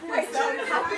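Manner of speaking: high, loud, half-laughing; bubbling over with happiness. Real, not acted. Speaker to sister, laughing through tears of joy. Overcome with emotion.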